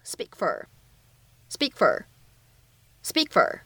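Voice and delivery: "speak for" linked, the k in "speak" held unreleased; incomplete plosion. Shown as a demonstration. Teacher models linking for learners, "speak for" with an unreleased k. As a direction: close on the k of "speak" silently, straight into "for". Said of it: "可以有一个不完全爆破的处理".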